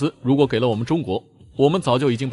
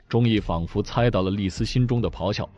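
ordinary delivery

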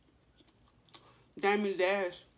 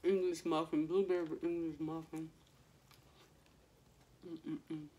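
talking while chewing; speaking around food; mouth partly full. Real, not acted. Seen 1: Bit muffin at -3 to -1, holds bitten piece while speaking.

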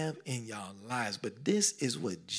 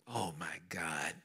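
awed whisper; 9 semitones lower, 11 dB quieter, slower than usual. Real, not acted.

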